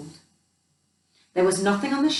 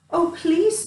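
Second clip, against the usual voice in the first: high, fed-up mouse scoff; exasperated mouse; 9 semitones higher, 3 dB louder than usual. Acted. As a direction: roll your eyes as a tiny mouse. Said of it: "thought Mouse".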